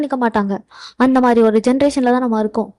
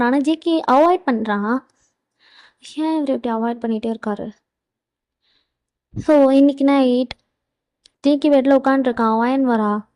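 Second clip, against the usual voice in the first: usual speaking voice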